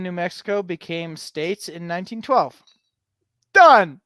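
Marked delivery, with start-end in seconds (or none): 3.5-4.0 loud, high flourish of triumph; proud showman's ta-da; triumphant ta-da. Real, not acted. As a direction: announce it like a magic trick. Triumphant announcement after finishing.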